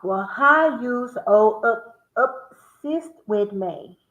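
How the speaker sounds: drawn out slowly; slow delivery. Shown as a demonstration. Hinting the song title to viewers, slow. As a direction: stretch each word slowly. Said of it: "I just saw you".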